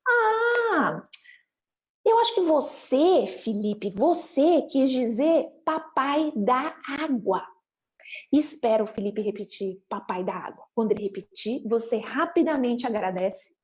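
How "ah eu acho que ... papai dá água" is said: high drawn-out realization, then slow modelling; sudden understanding. Acted. Parent explains to Felipe what they understood, sudden understanding. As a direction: spell it out slowly and emphatically for a child. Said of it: "aí você explica o que que você entendeu".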